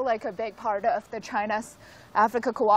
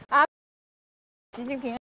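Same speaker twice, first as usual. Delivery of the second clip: out of breath; much faster than usual. Real, not acted. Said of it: "I'm out of breath".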